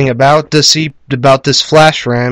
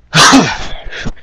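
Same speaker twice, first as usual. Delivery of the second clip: a long unvoiced sigh, winded; breathless sigh; 5 semitones higher than usual. Real, not acted. Tired sigh to the viewers after explaining, out of breath. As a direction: let out a tired, breathless huff. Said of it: "Out of breath".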